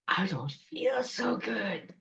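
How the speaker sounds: weak, soft, whispery sick voice; groaning troll witch. Acted. Troll witch after eating the coat, sickly whispered groan. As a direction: feeble creature moan, barely voiced. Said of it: "groaning and moving away".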